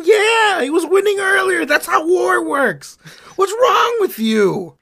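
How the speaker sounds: high, shouted disgust at Ptolemy; outraged Caesar. Acted. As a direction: yell at him like a furious general. Caesar scolding in acted quarrel.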